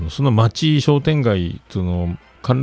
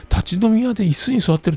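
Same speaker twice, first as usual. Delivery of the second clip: incredulous repeat; 12 semitones higher than usual. Retold or imitated. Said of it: "こいつよっぽど偉い人なんやろうな".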